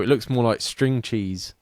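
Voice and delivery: normal talking voice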